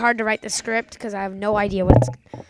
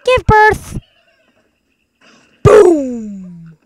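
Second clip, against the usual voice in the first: loud game shouts; 3 semitones higher, 10 dB louder, much slower than usual. Real, not acted. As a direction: shout as the bird hits. Launching birds, shouting.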